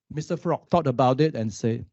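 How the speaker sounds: ordinary talk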